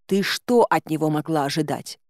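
plain reading voice

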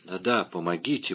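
тихая мольба умирающей; еле слышно просить о помощи; слабый шёпот. A narrator's reading. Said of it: "прошептала госпожа Бонасье". (тихо, почти шёпотом)

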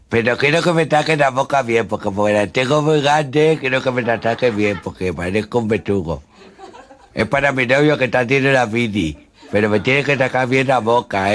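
high wide-mouthed voice, loud; boca grande. Acted. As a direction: speak through an oversized mouth. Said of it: "hablaba así".

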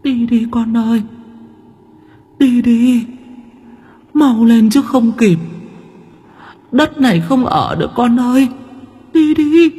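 put-on dead mother begging her children to run; ghost's urgent warning. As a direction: urge them to flee in a wailing ghost voice. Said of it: "Bà lắp bắp".

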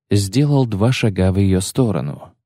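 usual speaking voice

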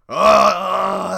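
huge loud high roar; ogre roar. Acted. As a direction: roar to scare him off. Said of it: "roaring very loudly".

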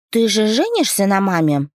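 put-on high, eager child voice; little girl voice. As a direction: ask like a curious little girl. Narrator voices little daughter.

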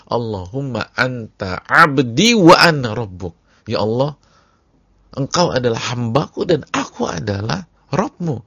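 ecstatic cry. Retold or imitated. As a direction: shout the prayer in overwhelming joy. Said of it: "sambil mengucapkan".

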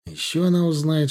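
ordinary delivery

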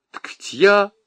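high-pitched, faltering protest; near tears. A narrator's reading. Nyura protests before bursting into tears, mildly tearful. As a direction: start to plead, on the verge of crying. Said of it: "и заплакала".

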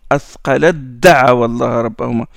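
quick tajwid recitation of the verse; Quran recitation. Shown as a demonstration. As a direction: recite it cleanly to show the elision. Recites verse to show elision.

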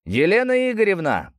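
shouting a name across; calling out. Acted. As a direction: call loudly into the empty mill. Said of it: "на всякий случай прокричал".

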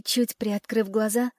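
ordinary delivery, nothing marked

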